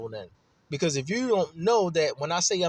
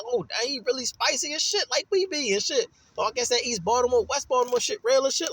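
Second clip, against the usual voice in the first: mock impressed outsider; 6 semitones higher than usual. Outsider reacting to his spazzing.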